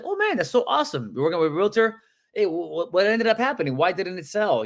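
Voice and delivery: high-pitched, enthusiastic interest; sounding excited. Acted. Agent to a client who already has a realtor, sounding excited. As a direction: react like it's great news, pitch leaping up. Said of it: "can you guys please sound excited".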